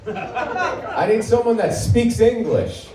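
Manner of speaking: high, slow, pushy lady voice; rude customer. Retold or imitated. Rude customer demanding English at Chipotle, high and slow. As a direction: talk down slowly like an entitled customer. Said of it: "this lady comes in and just goes".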